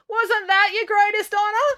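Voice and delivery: high, drawn-out heckle; heckling. A narrator's reading. April heckles Dad during his speech, indignant. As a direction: call out indignantly from the crowd. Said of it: "heckled April".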